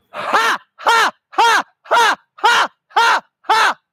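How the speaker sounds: loud, high rhythmic shouts; loud high chant of ha; shouting demo. Shown as a demonstration. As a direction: bark out each ha to wake the brain. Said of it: "Jump and shout".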